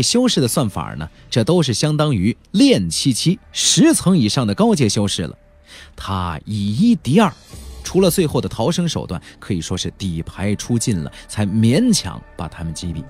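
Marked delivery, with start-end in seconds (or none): none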